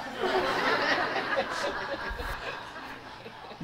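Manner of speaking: breathy high laugh; high laughter. Real, not acted. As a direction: burst out laughing at the joke. Speaker laughs at own punchline.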